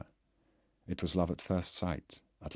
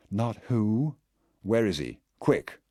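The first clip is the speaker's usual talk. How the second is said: urgent demand; 5 semitones higher, 5 dB louder, slower than usual. Acted.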